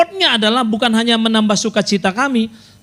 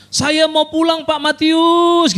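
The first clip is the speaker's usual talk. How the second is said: put-on high, pleading old woman's wail; mimicked old woman calling out; 8 semitones higher, much slower than usual. Pastor imitating the old woman begging to go home, high wail. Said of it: "Oma teriak-teriak melulu".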